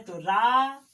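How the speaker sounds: रा said loud with a long आ; loud powered रा; forceful long aa. Shown as a demonstration. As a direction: hit रा hard. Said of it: "जोर से रा है".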